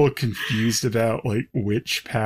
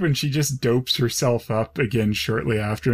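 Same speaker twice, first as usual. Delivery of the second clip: normal talking voice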